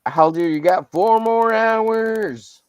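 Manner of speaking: high, slow, drawn-out ring announcer. Pretend. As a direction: boom it out like a fight announcer. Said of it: "Sorry if I've been annoying with the stupid voice".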